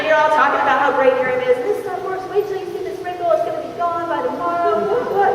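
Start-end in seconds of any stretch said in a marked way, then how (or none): 1.6-5.3 high-pitched giddy bragging; hammed-up product fan, bubbling over; all excited. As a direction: rave about it in a high voice. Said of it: "you're all excited".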